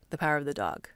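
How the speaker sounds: casual, throwaway title read. Shown as a demonstration. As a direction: toss the title off. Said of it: "like, kinda throwaway".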